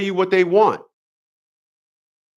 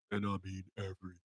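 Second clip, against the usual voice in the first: very low, quiet, dragged "and I mean every"; deliberately deep bass delivery; 12 semitones lower, 23 dB quieter, faster than usual. Shown as a demonstration. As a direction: drop into a deep rumbling voice for "every". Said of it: "That was my deep voice".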